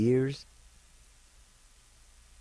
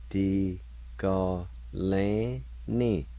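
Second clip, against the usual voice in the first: slow demo; much slower than usual. Shown as a demonstration. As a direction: Di ga lan ni stretched very slowly, each syllable drawn out. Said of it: "It's pronounced like this".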